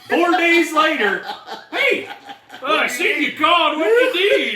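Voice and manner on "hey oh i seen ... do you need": high, loud, laughing mock greeting; mock casual friend. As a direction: play the friend who calls back days late, breezy. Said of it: "You know what he does when I call him?".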